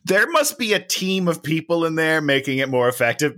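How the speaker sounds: ordinary talk, nothing marked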